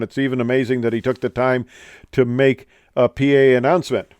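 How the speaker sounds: ordinary talk, nothing marked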